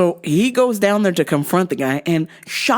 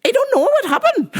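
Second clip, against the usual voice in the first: much higher mock lady voice; neighbor lady voice. Daughter mimicking the confused neighbor lady, high mock voice. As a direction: squeak it like the puzzled neighbor. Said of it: "And she's like".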